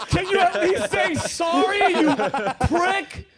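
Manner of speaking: high, loud-edged comic complaint; exasperated retold outburst; mock indignation. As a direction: demand an apology, half laughing. Acted demand for pilot's apology.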